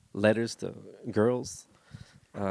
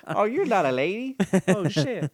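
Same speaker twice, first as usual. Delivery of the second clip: high-pitched comic disbelief; mock surprise; 9 semitones higher, 4 dB louder than usual. Guest playing the easily tricked friend, mock surprise. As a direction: play the fool who just found out, voice jumping up.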